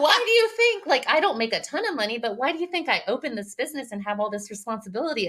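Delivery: high, loud start, easing into a rapid rant; retold exasperated comeback; incredulous retort. Owner answering an employee about making money, incredulous.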